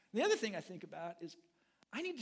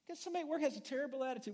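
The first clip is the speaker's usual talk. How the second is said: ordinary delivery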